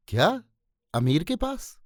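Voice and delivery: acted shock, rising at the end; fearful astonishment. A narrator's reading. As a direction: react in alarmed disbelief, pitch jumping up. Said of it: "भय और आश्चर्य से फैल गया".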